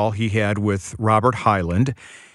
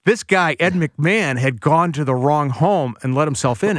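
usual speaking voice

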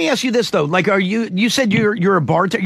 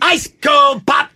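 vendor shout; 7 semitones higher, 4 dB louder, slower than usual. Retold or imitated. Vendor call again.

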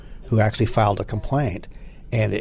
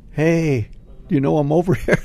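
louder, higher joking call for attention; playful plea to passers-by, raised voice; mock calling out; 6 semitones higher, 4 dB louder than usual. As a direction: call out through the wire, breaking into a laugh. Imagined calling out through the fence.

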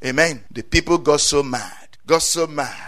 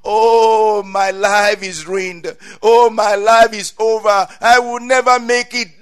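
louder mock wail of a ruined life; hammed-up lament over ruined life; mock despair; 5 semitones higher, 6 dB louder than usual. Imitates despairing speech.

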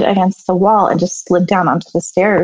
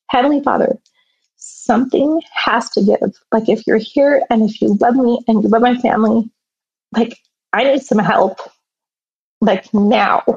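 a mild echo of praying through tears; tearful prayer; 4 semitones higher than usual. Retold or imitated. Alone and crying, begging Heavenly Father for help, higher pleading voice. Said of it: "just crying and saying".

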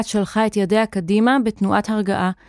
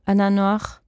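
ordinary delivery, nothing marked

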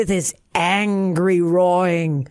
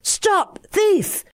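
shout; 12 semitones higher than usual. A narrator's reading. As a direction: yell after a thief. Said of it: "they heard a shout of".

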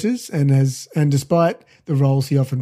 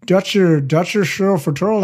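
put-on playful Dutch accent, pitched up; 4 semitones higher than usual. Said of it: "That's my Dutch accent".

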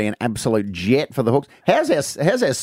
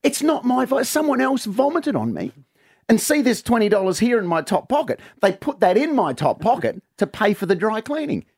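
much higher, frantic pleading denial; pleading drunk husband; 9 semitones higher than usual. Acted. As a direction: beg her like a caught-out drunk. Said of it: "He goes".

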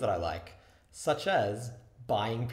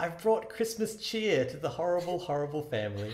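grand mock-heroic; 5 semitones higher than usual. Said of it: "And I was like".

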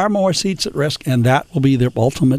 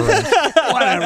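laughing; 13 semitones higher, slower than usual. Real, not acted.

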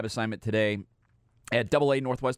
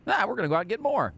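higher, quick mock-agent voice; lightly mimicked agent; 10 semitones higher, faster than usual. Host mimicking the agent who turned down Astros money, higher and quick. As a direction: lightly imitate a dismissive agent, pitched up and quick. Acting out the agent's overconfident refusal.

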